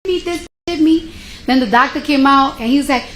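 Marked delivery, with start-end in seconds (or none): 0.0-1.1 high, shrill-free mock accusation, pitched way up; mimicking frantic accusation. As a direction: imitate the receptionist crying foul. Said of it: "she just started wilding out".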